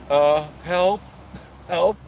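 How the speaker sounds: high, loud playful call for help; mock cry for help. Joking to viewers about a made-up emergency, loud mock cry. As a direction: yelp it like a pretend emergency.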